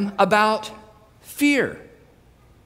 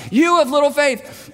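high, raised call over the storm; projected, pitched-up reproach; yelled rebuke; 7 semitones higher, 3 dB louder than usual. Acted. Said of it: "He's having to yell this".

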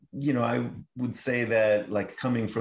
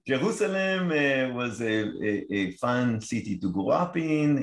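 put-on laughing Israeli accent, pitched up; thick Israeli accent with a laugh; 5 semitones higher than usual. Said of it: "In my Israeli accent, you say?".

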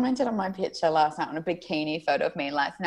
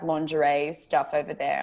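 usual speaking voice

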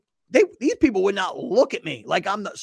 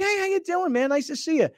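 phony cheerful greeting; 11 semitones higher than usual. Shown as a demonstration. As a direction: greet them like you mean it, but you don't. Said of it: "I'm happy enough to be phony".

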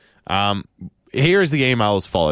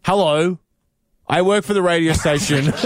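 raised, formal reading of the opening; formal letter voice; 8 semitones higher than usual. Shown as a demonstration. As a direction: read it like a stiff business letter. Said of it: "No, I wrote".

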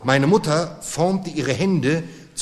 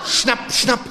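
mother hinting; 8 semitones higher than usual. Acted. Mother hints with pincer hands.

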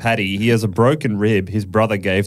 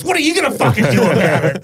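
high laughing taunt; 9 semitones higher, 3 dB louder, faster than usual. Retold or imitated. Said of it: "He goes".

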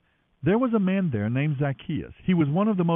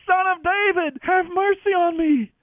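shouting louder; 5 dB louder than usual. A narrator's reading. Beggar defying the hushing crowd, louder shout. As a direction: cry out even louder, begging. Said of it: "he only shouted louder".

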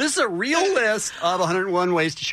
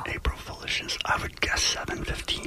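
hushed secret-telling whisper; conspiratorial whisper; 7 dB quieter than usual. Pretend. Radio host teasing tomorrow's announcement, whispering. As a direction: whisper it like classified news. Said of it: "Why are you whispering?".

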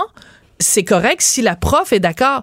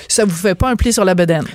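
ordinary talk

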